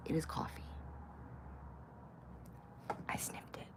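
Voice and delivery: whispered confession. Real, not acted. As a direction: admit it in a whisper.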